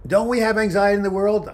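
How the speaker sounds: exasperated outburst. Real, not acted. Vlogger venting to viewers about anxiety, loud and high. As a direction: blurt it out in exasperation.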